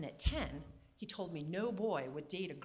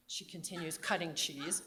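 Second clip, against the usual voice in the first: ordinary talk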